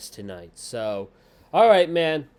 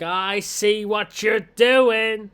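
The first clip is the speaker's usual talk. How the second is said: high, loud, drawn-out accusation; hammed-up angry promo yell; mock set-off outburst; 9 semitones higher, 8 dB louder, slower than usual. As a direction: imitate a wrestler losing it, stretching the words. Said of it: "this sets off Becky".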